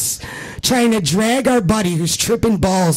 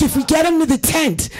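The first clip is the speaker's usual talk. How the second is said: rallying plea; 9 semitones higher than usual. Retold or imitated. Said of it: "rallying the troops".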